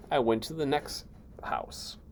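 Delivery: conspiratorial whisper. Acted. Wolf whispering to the reader about the next house. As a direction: hush it like a sly aside.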